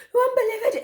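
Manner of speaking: high, rushed words after a gasp; imitated breathless panic; out of breath. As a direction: act winded and in shock. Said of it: "I was like, out of breath".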